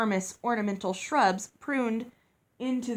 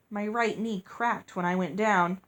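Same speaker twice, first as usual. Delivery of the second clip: plain reading voice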